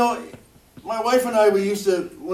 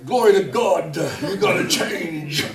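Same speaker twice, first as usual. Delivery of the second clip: mock pulpit voice, dragged out slowly; preaching voice; 2 semitones lower, slower than usual. Husband lecturing his wife in a mock preaching voice. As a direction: put on a lightly hammed preacher tone to lecture her. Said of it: "I put on my preaching voice".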